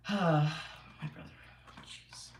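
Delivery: sighing, drawn-out and slow; fed-up sigh about the noisy brother; exasperated sigh. Real, not acted. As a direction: let out a weary groan at the interruption.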